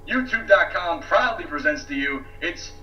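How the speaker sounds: showy ringmaster announcement. Pretend. Circus-style self introduction.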